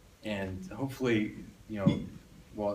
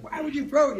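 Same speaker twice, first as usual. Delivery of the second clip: high, louder mock yell at the child; mock scream; 6 dB louder than usual. Parents yelling at daughter over flip-flop, high mock yell. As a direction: yell it high in comic exasperation. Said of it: "'cause we scream, like".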